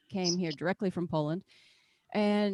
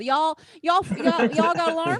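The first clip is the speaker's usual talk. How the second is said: mock Southern; 7 semitones higher, 7 dB louder than usual. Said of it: "I'm not even from the South".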